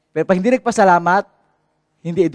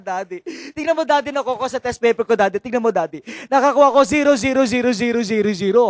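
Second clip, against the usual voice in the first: put-on high, laughing kid showing off his test; playful child's voice breaking into laughter; 5 semitones higher, faster than usual. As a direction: giggle through it like a proud child. Child proudly shows zero score.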